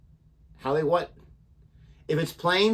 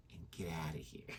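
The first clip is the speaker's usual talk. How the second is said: breathy whisper, much softer, dropping low; whispered punchline; 13 semitones lower, 22 dB quieter than usual. Acted. Host whispering the joke's end to her co-host. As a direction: hush the punchline right at the mic. Joke telling a co-host to leave.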